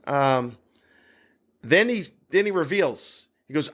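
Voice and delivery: ordinary talk, nothing marked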